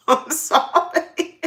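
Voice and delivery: high, slow giggly laughing I'm sorry; laughing apology. Real, not acted. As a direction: apologize while you crack up.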